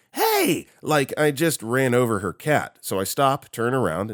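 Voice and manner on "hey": very high-pitched startled yelp; hammed-up high scream; mock scream. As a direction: squeal it like an outraged walker. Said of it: "flails her arms and screams".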